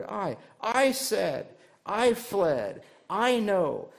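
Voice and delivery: stressing "I" to show self at the core of anger; emphasis on I. Shown as a demonstration. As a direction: hit every "I" hard. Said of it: "the emphasis on the word".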